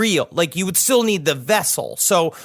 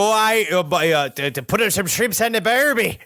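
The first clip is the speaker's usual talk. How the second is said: put-on halting, higher-pitched mangled Australian accent; bad Australian accent; 3 semitones higher than usual. Impostor ghost talking his way in, bad Australian accent. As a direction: stumble through a fake Australian accent. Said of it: "In order to do Australian".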